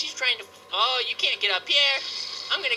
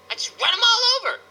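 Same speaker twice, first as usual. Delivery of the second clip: high, quick excited exclamation; comic bloodthirsty glee; gleeful boast; 9 semitones higher, 4 dB louder, faster than usual. Pretend. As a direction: burst out with playful relish. Gleeful boast about killing zombies.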